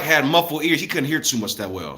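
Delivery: ordinary talk, nothing marked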